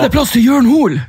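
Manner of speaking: high put-on singer's voice; high, playful mock-singer pitch. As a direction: ask it in a high mimicking voice. Imitating singer [name].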